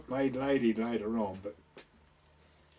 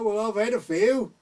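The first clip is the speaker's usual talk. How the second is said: put-on higher, louder sheepish drunk reply; tipsy admission; 8 semitones higher, 7 dB louder than usual. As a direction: own up loudly, tipsy. Drunk lad admits it.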